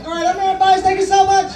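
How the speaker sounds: excited closing shout to the audience; loud sign-off. Real, not acted. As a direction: shout your name and thanks to the crowd. Comic signing off loudly.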